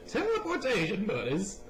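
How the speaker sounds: put-on a cheerful Southern accent for a buddy; hammed-up Southern buddy voice. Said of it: "Not sure why I put on the southern accent there".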